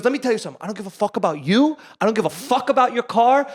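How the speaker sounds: emphatic, hammed-up telling-off; imitated yelling. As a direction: act out a cop laying down the law. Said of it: "he's just yelling in this guy's face".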